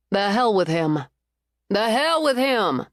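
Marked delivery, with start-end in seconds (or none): 1.7-2.9 slow, higher defiant echo of the phrase. Acted. Cleo echoing Winter's dismissal of the ex, slow defiant toast. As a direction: raise "The hell with him" like a toast. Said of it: "Cleo echoed".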